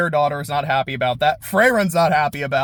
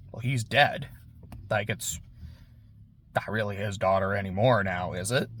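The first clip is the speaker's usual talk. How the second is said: lower, soft, chillingly casual; cold demon; 7 semitones lower, 9 dB quieter than usual. Retold or imitated. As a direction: say it quietly and lower, utterly unbothered. Demon coldly dismisses dead chief.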